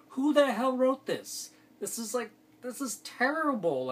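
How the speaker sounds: high, loud disbelief at "Who the hell"; incredulous. Retold or imitated. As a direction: play a viewer appalled by the script. Imagined outraged new viewer.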